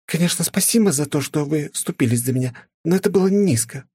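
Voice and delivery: высокий надменный голос с упрёком; фыркнуть с обидой; фыркнув. A narrator's reading. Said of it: "фыркнула она". (фыркнула)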